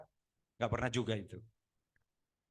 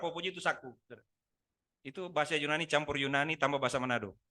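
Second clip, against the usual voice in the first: normal talking voice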